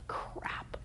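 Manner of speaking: defeated whispered curse. Retold or imitated. Trapped by the contract, whispered curse. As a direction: breathe the curse out, caught.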